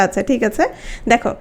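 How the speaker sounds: ordinary delivery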